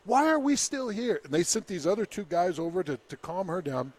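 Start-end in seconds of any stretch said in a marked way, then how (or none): 0.0-1.2 imitating a yelling woman. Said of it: "woman was yelling at one of the Mounties".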